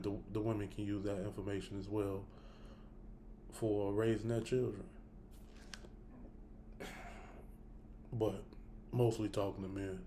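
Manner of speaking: hiccuping while talking; hiccups. Real, not acted. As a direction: talk through the hiccups. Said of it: "I got the hiccups".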